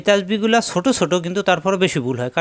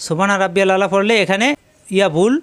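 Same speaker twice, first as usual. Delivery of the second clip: usual speaking voice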